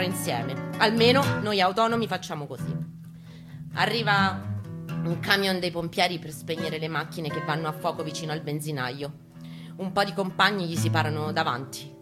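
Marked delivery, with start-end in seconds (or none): none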